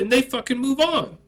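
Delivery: ordinary delivery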